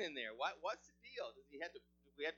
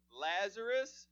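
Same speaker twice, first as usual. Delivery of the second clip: "Lazarus" called out louder; calling out Lazarus; 4 semitones higher, 9 dB louder, slower than usual. Acted. Jesus calls Lazarus by name out loud. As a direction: call "Lazarus" from outside the tomb. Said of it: "and he's speaking to you now".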